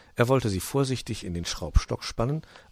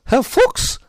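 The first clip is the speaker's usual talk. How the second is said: high, loud call from outside; 12 dB louder than usual. A narrator's reading. Magpie calling the fox from outside, loud and high. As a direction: call out shrilly to someone indoors. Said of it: "rief es von draußen".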